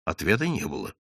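plain reading voice

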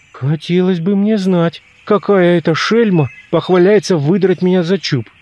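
ordinary delivery, nothing marked

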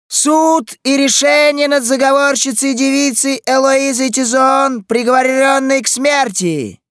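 loud, high proclamation shouted down the street; town crier. A narrator's reading. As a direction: cry out the sentence like a herald. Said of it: "раздался хриплый голос глашатая". (громкий крик глашатая)

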